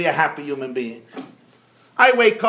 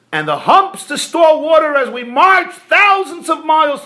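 louder and higher, shouting out the list; rising outcry; 8 semitones higher, 5 dB louder than usual. Acted. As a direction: build to a loud cry on thousands. Baby camel building its argument.

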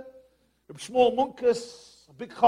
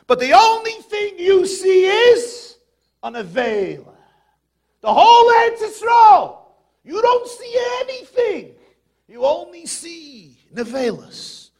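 much higher and louder, stretched out; full preaching shout; 6 semitones higher, 8 dB louder than usual. Real, not acted. Preacher at full force to the crowd, shouting. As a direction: cry it out from the pulpit.

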